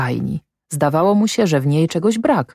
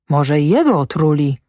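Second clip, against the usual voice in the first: ordinary delivery, nothing marked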